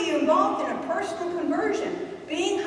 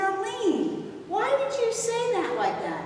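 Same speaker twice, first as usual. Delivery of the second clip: Arlene said higher, taken aback; hurt surprise; 7 semitones higher, slower than usual. Retold or imitated. Retelling asking a friend why she snapped, lightly stung. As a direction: ask it startled and a little hurt. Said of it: "And I said".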